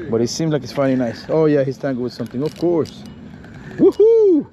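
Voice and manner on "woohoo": high, loud cheer; excited whoop. Real, not acted. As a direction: whoop it up over the catch. Fish on the line, cheering.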